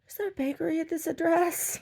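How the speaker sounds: very high-pitched mock voice; woman customer. Woman asking at the gallery, very high mock voice. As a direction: put on a piping, puzzled question.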